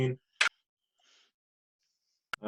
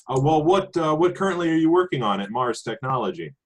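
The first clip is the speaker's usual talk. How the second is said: a louder, higher on-air reporter pitch; 6 semitones higher, 5 dB louder than usual. Acted. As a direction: put on the polished news-anchor charm. Reporter pressing for information.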